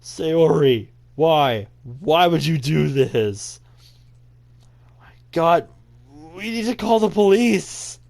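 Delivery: loud, high, acted distress; anguished. Friend finding Sayori dead, anguished.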